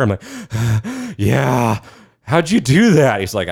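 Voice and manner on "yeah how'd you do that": high, panting rookie voice; winded amazement. Acted. Winded rookie to the training officer, breathless and amazed. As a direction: catch your breath, then ask in high-pitched disbelief.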